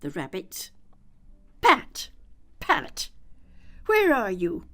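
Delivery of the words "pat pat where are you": loud, high, furious calling; angry shout. A narrator's reading. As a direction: bellow for Pat in a rage. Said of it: "Next came an angry voice, the rabbit's".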